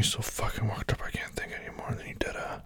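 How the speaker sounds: hushed words tumbling out on the breath; soft, airy rush; breathless whisper. Acted. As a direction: whisper it, worked up. Breathless intimate talk to partner.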